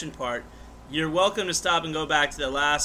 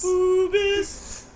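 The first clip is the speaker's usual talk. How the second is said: high squeaky; 14 semitones higher, 4 dB louder than usual. Pretend. High mocking repeat.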